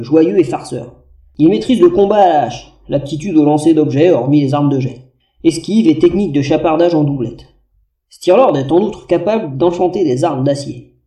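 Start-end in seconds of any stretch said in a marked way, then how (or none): none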